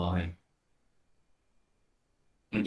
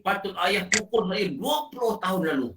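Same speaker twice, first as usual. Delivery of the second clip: higher-voiced son's regret; remorseful son; 5 semitones higher than usual. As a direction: plead like the son who wishes he'd been disciplined.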